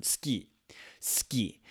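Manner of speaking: Ski, with the u dropped so suki sounds like "ski"; devoiced suki. Shown as a demonstration. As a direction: say suki as one-syllable "ski". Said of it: "swallow that sound up".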